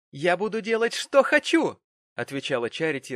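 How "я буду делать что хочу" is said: high, loud shout climbing in pitch; furious daughter's yell; rising defiant shriek. A narrator's reading. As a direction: screech defiance at your father. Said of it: "повышая голос до пронзительного визга".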